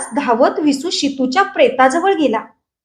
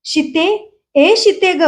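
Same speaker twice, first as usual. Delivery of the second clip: higher, restrained cry of a name; high-pitched calling; 4 semitones higher, slower than usual. A narrator's reading. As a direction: call out on a raised note, without full volume. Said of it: "तार स्वरात तो ओरडत होता". (तार स्वरातली हाक)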